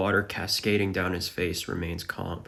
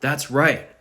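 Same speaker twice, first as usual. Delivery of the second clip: high, loud backing of a protest; raised voice joining the plea; emphatic agreement; 6 semitones higher, 8 dB louder than usual. A narrator's reading. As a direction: call out in support. Second slave backs the plea.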